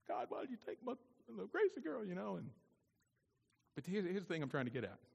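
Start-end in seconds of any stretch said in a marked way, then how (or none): 0.1-2.0 high, soft plaintive plea; small high-pitched mock wail; mock bawling. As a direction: imitate a tearful prayer, lightly hammed up. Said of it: "I bawled like a baby".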